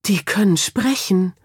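mildly dumbfounded murmur of disbelief; stunned stammer. A narrator's reading. Boy realizing the animals talk, stunned stammer. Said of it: "stammelte Finn entgeistert".

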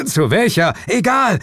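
high-pitched, loud frantic yells; urgent shouting. A narrator's reading. Detective and partner mid-chase, yelling urgently. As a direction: shout back and forth on the run.

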